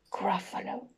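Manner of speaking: ordinary delivery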